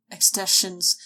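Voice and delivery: ordinary talk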